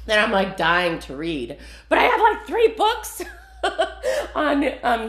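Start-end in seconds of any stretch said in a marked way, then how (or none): 1.9-5.1 high, giggly laughing words. Real, not acted. Laughs at own book pile.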